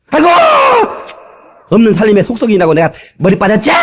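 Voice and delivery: mock angry wife. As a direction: flare up like an offended wife. 부부싸움 아내 흉내. (아내가 남편에게 크게 화내며 따지는 소리)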